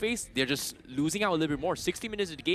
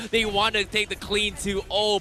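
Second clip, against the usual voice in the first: ordinary delivery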